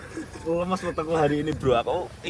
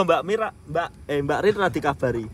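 ordinary talk, nothing marked

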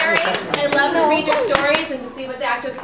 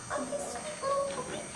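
high, quick, sweet little-puppy voice; 10 semitones higher, 13 dB quieter, faster than usual. Pretend. Kid showing her puppy role, high and quick. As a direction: squeak it out fast like a tiny puppy. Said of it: "I could play a puppy".

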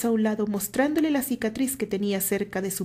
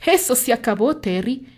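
louder, firm and final; steady firm statement; 4 semitones higher, 6 dB louder than usual. A narrator's reading. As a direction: shut the matter down. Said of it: "dijo Richard firmemente".

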